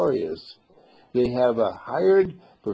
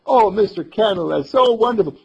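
put-on high, loud child voice; excited little boy; 9 semitones higher, 8 dB louder than usual. Boy wakes and calls Mister Candle, excited high voice. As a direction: burst out with the news in a boy's voice.

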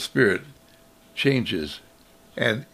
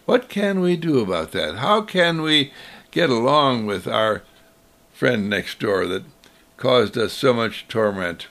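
ordinary delivery

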